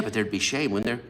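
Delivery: ordinary talk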